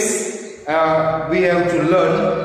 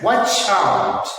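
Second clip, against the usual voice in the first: "watch out" with punched emphasis on "watch"; sentence stress demo; 3 semitones higher than usual. Shown as a demonstration. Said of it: "where you have to stress".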